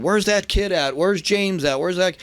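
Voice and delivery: high, insistent search call. Retold or imitated. Boss hunting for the wrestler backstage, urgent high call. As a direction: imitate a boss demanding someone's whereabouts.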